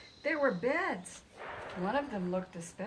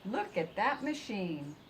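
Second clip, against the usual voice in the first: ordinary delivery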